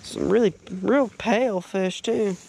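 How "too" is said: normal talking voice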